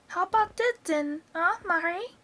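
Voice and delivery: put-on high, cackly old grandma calling down; high-pitched old woman showing off; Grandma's voice. A narrator's reading. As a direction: crow it from the rooftop as Grandma. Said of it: "Grandma shouted".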